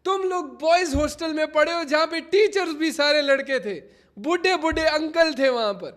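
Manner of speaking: loud mock-indignant rant; loud scolding. Real, not acted. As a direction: tell the chat off loudly. Streamer scolds laughing chat.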